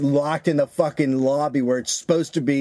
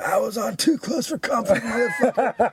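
high-pitched mock yell; hammed-up high holler; retold parting yell; 10 semitones higher than usual. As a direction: imitate a retreating man yelling back. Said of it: "He did yell as he was walking away".

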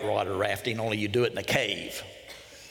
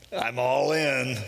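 long drawn-out mock cry; committed leap cry; slower than usual. Jumper calls out on leaping off the ledge, drawn-out cry. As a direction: stretch it out like someone mid-jump.

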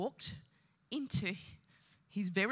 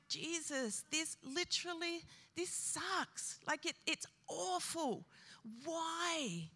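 high, strained lament, pitch raised; anguished lament; 7 semitones higher, 5 dB quieter, slower than usual. Retold or imitated. Preacher retelling his prayer on way to funeral, grieving high. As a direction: cry out in grief while driving. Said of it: "I'm like".

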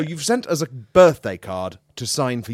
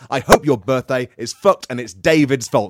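mock outraged rant; 4 semitones higher, 10 dB louder than usual. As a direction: rant it loud at the sender.

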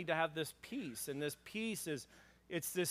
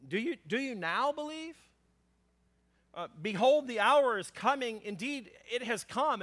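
higher, mock-surprised questioning; pitched-up sarcastic reading; 7 semitones higher, 5 dB louder than usual. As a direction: read it as a sarcastic jab at the disciples. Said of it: "this is a bit sarcasm".

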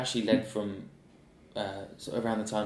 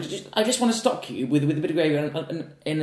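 demanding customer; 6 semitones higher, 4 dB louder than usual. Retold or imitated. Imitating the customer asking for plain stock, mock demanding. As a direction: ask for stock like a fussy diner, hammed-up. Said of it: "I'm like".

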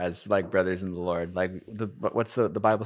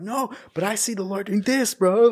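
high, strained retort, louder at the end; mock heated argument; 13 semitones higher, 5 dB louder than usual. Arguing back at Nick about the Lord, high and pushed. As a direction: fire back in a pinched, straining voice. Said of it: "we were in Israel yelling at each other".

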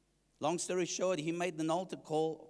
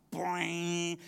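ringing, drawn-out guitar noise; imitated guitar twang. Churchgoer recalls the worship leader starting up, imitated guitar twang. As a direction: mimic an electric guitar being struck.

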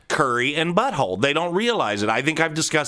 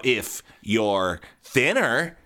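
ordinary talk, nothing marked

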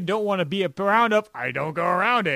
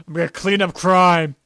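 loud, punchy hero declaration; mock superhero; 9 dB louder than usual. Playing Bathman vowing to fight crime, mock superhero. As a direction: boom it out like a joke superhero.

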